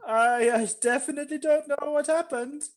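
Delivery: put-on high-pitched, evasive German accent. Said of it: "so that I don't lose the accent".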